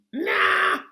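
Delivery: high, loud cartoon passenger, slow and sure; odd passenger voice. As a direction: squeak it out like a goofy know-it-all.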